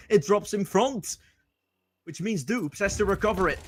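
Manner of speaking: rising, hyped live play-by-play; esports caster. Acted. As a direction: call the action, building excitement.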